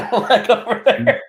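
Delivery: high, laughing echo; amused, high-pitched laughing repeat. Real, not acted. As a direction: repeat it through real laughter. Amused echo of odd find.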